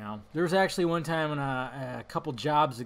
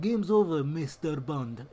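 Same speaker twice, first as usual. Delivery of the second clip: mock villain, slowing on "Mister Bond"; hammed-up accented Bond villain; slower than usual. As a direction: deliver it like a foreign movie villain. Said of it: "he sounded like a Bond villain".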